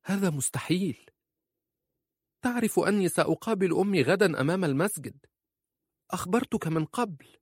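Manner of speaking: high, insistent objection; wife protesting. A narrator's reading. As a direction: protest in a high-pitched woman's voice.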